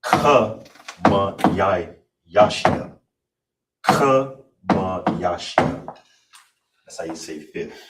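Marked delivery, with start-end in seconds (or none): 0.0-6.0 slow, syllable by syllable, Ka ma yashia; Hebrew number read slowly. Shown as a demonstration. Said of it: "So all together".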